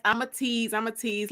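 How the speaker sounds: usual speaking voice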